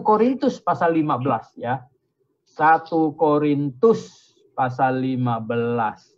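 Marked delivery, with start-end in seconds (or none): 2.4-6.1 half-speed, low and measured; drawn-out announcement for note-takers; slow dictation. Real, not acted. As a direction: spell out the reference word by word. Repeats reference slowly for listeners.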